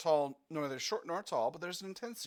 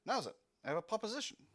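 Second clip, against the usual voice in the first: put-on German accent; 7 semitones higher, faster than usual. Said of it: "edged with a German accent".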